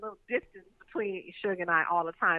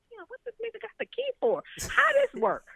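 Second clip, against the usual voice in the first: much higher, puzzled voice; baffled surprise; 14 semitones higher than usual. Retold or imitated. Telling a friend how the hotel key baffled her, high and puzzled. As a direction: sound baffled and high-pitched. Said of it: "And I'm like".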